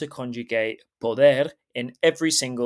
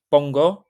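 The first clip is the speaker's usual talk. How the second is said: pongo, louder and rising on the end; emphatic pongo; 3 semitones higher, 6 dB louder than usual. Shown as a demonstration. Teacher drilling the key form pongo. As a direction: punch out pongo so it sticks. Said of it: "So remember this".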